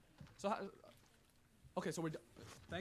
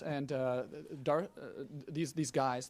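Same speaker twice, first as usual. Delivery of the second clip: ordinary talk, nothing marked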